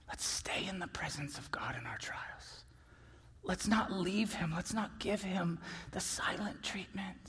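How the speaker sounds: low, soft near-whisper pleading; hushed pastoral appeal. Real, not acted. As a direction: appeal gently, dropping the voice.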